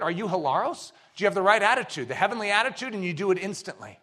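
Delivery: ordinary talk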